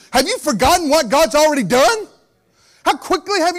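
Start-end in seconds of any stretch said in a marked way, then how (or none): none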